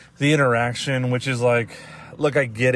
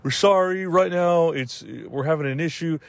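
higher-pitched mock customer apology; apologetic management announcement; 5 semitones higher than usual. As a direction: imitate a manager apologising to the audience. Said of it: "he was gonna come in and tell us".

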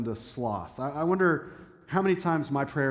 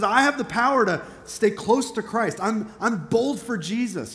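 higher, louder self-confident proclamation; arrogant boast; 7 semitones higher, 5 dB louder than usual. Acted. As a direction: declare your own boldness, drawing it out at the end. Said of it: "Think of the arrogance".

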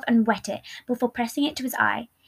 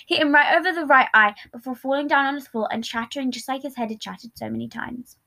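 ordinary delivery, nothing marked

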